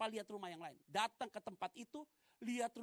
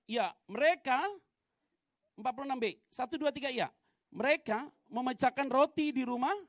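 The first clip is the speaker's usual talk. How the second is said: loud, measured call to read together; leading congregational reading; 5 semitones higher, 12 dB louder than usual. Real, not acted. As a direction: lead the whole congregation through the verse, raised voice. Leads congregation reading aloud. (memimpin jemaat membaca ayat dengan lantang)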